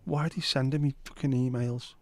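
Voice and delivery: ordinary talk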